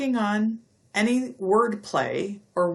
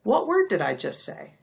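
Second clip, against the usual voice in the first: usual speaking voice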